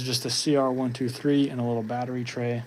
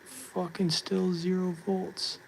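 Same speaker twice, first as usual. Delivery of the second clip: exasperated; 7 semitones higher, much slower than usual. Real, not acted. Exasperated repeated zero reading.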